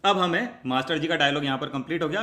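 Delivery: usual speaking voice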